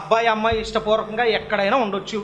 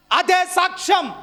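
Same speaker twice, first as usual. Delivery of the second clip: emphatic declaration; 9 semitones higher, slower than usual. Acted. Preacher declaring the point.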